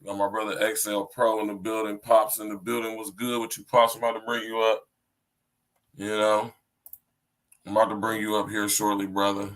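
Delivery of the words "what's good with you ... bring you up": ordinary delivery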